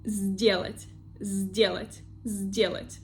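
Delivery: сделать begun with a buzzing Z, "zdelat"; voiced first sound. Shown as a demonstration. Teacher showing two voiced consonants, Z in сделать. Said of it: "we have two voiced consonants".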